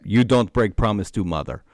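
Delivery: put-on light Russian accent, dropping the articles; a hint of a Dagestani fighter's Russian accent. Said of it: "you need to do exclusively in that accent".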